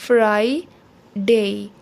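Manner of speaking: फ्राइडे slowly as "fry - day", gap between; split into syllables. Shown as a demonstration. Teacher shows Friday has two parts, फ्राइडे split syllable by syllable. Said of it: "इसके दो parts हैं".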